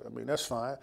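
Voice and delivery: usual speaking voice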